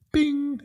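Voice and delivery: very high, ringing bing; imitated bing. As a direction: sing out a high little ding. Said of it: "in my head".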